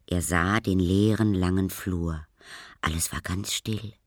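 ordinary delivery

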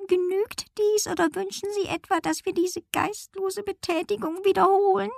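very high, soft meowing voice; haughty grumpy cat. A narrator's reading. Cat finishes the silly jump, haughty meowing. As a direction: ask with aloof, feline disdain, high and sustained. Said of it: "Frau von Schmidt blickte mürrisch drein".

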